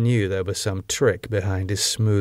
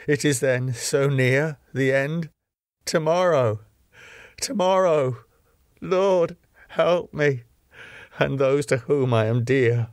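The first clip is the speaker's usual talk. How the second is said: high, pleading prayer; desperate prayer; 4 semitones higher than usual. Acted. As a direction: plead to God in fear. Praying on his knees, terrified.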